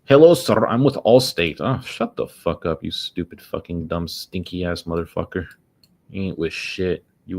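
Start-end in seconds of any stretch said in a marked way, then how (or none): 0.0-1.6 loud mock Indian call-centre accent; mock Indian telemarketer greeting. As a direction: imitate the telemarketer, high and loud. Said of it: "I got a call from some Indian".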